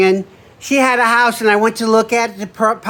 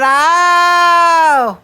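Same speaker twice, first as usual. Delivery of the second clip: surprised oh; 7 semitones higher, 4 dB louder than usual. Retold or imitated. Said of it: "And she said".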